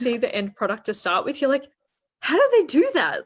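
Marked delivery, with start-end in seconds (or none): 2.2-3.2 high, loud burst of wonder; acted-out astonished question; amazed disbelief. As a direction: voice leaps up, marvelling and incredulous. Acting out a viewer's amazed reaction.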